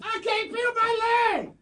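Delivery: high, far, hammed-up injured cry; shouting while wounded. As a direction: wail like a fake accident victim. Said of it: "It's kinda incoherently".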